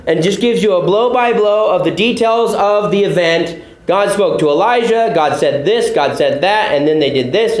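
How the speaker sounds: ordinary talk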